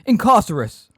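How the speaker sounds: loud, high cry; screamed spell. A narrator's reading. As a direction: scream the incantation as an attack. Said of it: "pointed her wand at Megorian and screamed".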